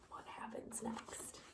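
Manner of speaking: slow hushed suspense whisper. Acted. Reader asking children what comes next, in a whisper. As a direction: whisper it like a secret. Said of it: "whispered question to children".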